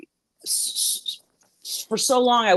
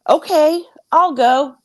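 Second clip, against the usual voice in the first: reluctant agreement; 9 semitones higher, 3 dB louder, slower than usual. Retold or imitated. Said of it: "And I said".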